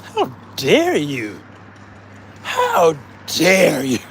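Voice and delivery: slow, hammed-up indignation; mock offended. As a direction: pretend to be scandalised. Mock offended over wiener bin.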